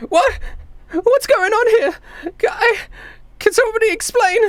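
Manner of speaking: put-on very high-pitched confused kid; small kid demanding answers; bewildered child. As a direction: plead in a high little child's voice. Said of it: "he's like".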